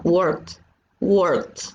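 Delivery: "worked" as one syllable, final T sound; "worked" pronounced wort, no ed vowel; "worked" with T ending. Shown as a demonstration. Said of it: "no suena ed, suena como ... una T".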